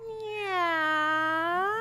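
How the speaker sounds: high, drawn-out meow; cat meow. Shown as a demonstration. Teacher invites kids to make cat sounds, high meow.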